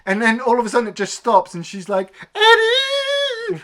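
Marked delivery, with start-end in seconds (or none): 2.4-3.6 very high, loud drawn-out name call; mock screech. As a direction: imitate a woman screeching his name. Leslie screaming for Eddie.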